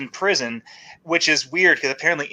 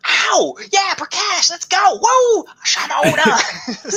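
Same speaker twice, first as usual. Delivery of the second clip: high-pitched pop-star whoops and yelps; hammed-up falsetto impersonation, shrieking out the catchphrases; mock pop idol, squealing way up high; 3 dB louder, slower than usual. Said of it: "he's just doing a [name] impression".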